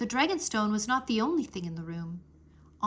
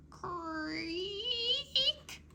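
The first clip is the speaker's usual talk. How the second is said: high drawn-out creak; creaking door; 11 semitones higher, 5 dB quieter than usual. A narrator's reading. Narrator voicing the door creaking open. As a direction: imitate a stone door creaking.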